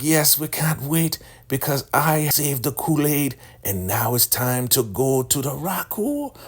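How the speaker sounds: proud elder woman. Retold or imitated. Elder woman boasting she saved the Kool-Aid, high gushing voice. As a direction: savor every word like a proud believer.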